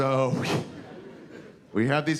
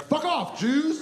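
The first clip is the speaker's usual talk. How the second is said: high, shouted goy taunt; mock yell; slower than usual. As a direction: yell it like a fleeing troublemaker. Goy yells and runs off.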